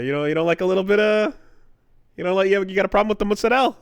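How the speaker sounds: laughing Jersey Italian gangster voice; mock mobster. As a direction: needle him like a wise guy. Mobster-style taunt.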